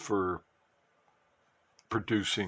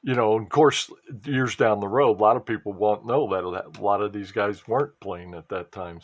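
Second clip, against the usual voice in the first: ordinary delivery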